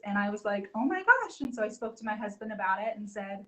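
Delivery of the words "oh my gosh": surprised exclaim. Retold or imitated. Recalls reacting to a news post.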